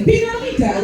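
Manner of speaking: very high cartoon call of an Italian man; hammed-up, high-pitched Italian man; mock Italian man. Comic playing a man in Italy named Lakeisha, hammed-up call. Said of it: "a man in Italy named Lakeisha".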